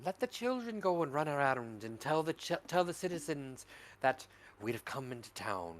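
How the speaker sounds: ordinary delivery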